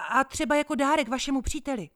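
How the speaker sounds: high, loud, fast sales patter; eager pitch. Acted. Boy pressing the gentleman to buy, fast and high. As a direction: talk him into it quickly. Boy not giving up sale.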